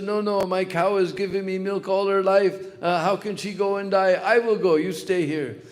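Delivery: put-on fighting cowherd boy. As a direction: insist loudly he'll go in the cow's place. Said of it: "They were all fighting like this".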